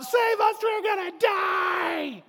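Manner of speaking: high, loud, panicked shout for rescue; panicked cry. Retold or imitated. Disciples in the storm begging Jesus, panicked shout. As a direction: cry out like drowning men.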